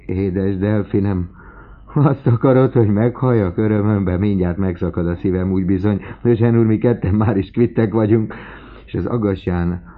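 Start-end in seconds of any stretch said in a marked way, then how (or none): 0.1-8.3 loud, raised-pitch joyful outburst; heartfelt, elated and loud; overjoyed father. A narrator's reading. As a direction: exclaim as if overwhelmed by happiness. Father overwhelmed by joy.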